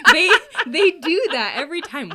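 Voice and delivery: high, loud through laughter; laughing at how guys always text. Real, not acted. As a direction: laugh it out in disbelief. Laughing about guys' texts.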